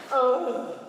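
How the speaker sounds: a groaning oh. Shown as a demonstration. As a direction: groan about the mortgage bill. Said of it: "you're gonna just grow".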